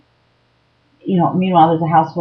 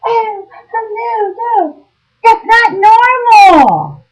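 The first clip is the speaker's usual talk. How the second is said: very high, slow, loud disbelief; incredulous outburst; 7 dB louder, much slower than usual. Shown as a demonstration. Telling victims how to react, very high shocked cry. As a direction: screech it as if it's outrageous. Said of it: "and you should be".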